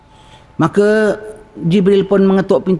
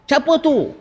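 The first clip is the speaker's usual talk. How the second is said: high, sharp challenge; harsh gatekeeper; 11 semitones higher, slower than usual. Retold or imitated. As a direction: bark it like a suspicious guard. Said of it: "Ya, garangnya". (nada garang)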